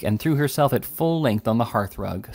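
ordinary delivery, nothing marked

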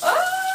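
startled high yelp; sharp yelp from cold. Real, not acted. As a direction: yelp as cold water hits. Cold water hits her.

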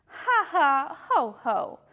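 mock-hearty knightly laugh; scoffing laugh. A narrator's reading. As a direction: laugh it off word by word. Small knight scoffs in rhyme.

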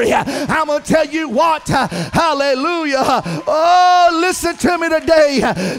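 loud, rising preacher's shout; full-force sermon cadence; fiery preaching. Acted. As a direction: preach it hard to the congregation.

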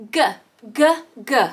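short, separate "G" sounds; letter-sound demo. Shown as a demonstration. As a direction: sound out hard "G", like "guh". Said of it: "It makes a G sound".

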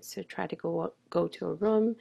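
ordinary delivery

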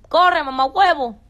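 loud, high, playful cry; excited shout. Real, not acted. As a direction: shout it out with glee. Excited Dominican catchphrase shout.

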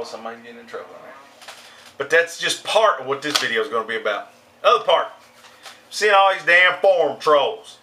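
ordinary talk

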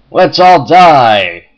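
loud, slow gleeful villain cheer. Pretend. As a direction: cheer everyone to their doom. Gleeful cheer as pieces fall.

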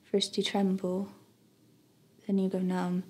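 soft, grieving narration fading out; tragic heroine sorrow. A narrator's reading. Somber memoir narration of death.

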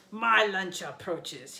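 slow, loud monster voice, amused; gloating giant boast; laughing giant. A narrator's reading. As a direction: relish the coming meal. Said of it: "The giant laughed".

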